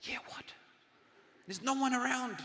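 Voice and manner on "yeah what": short hushed whispered reply. Acted. Friend answers a needless whisper, whispering. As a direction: whisper back, playing along. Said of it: "You just whisper".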